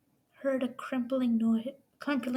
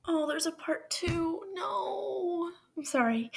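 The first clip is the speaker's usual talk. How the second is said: tearful dismay; 4 semitones higher than usual. Real, not acted.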